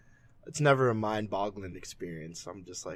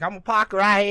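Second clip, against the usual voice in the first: high, loud Boston accent attempt; bad Boston accent; 11 semitones higher, 7 dB louder than usual. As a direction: fake a thick Boston accent, badly. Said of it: "I can't do a Boston accent. That was terrible".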